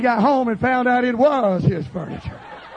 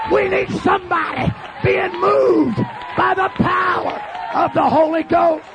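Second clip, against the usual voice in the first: impassioned, shouted revival sermon; full-force preaching; 6 semitones higher than usual. Acted. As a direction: preach it hard to a shouting congregation.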